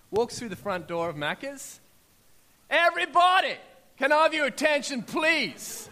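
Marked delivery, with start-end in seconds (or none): 2.7-5.9 big retold shout to the whole restaurant, much higher and louder; calling out. A man shouting to the whole McDonald's for attention. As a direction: announce it at full voice to grab attention.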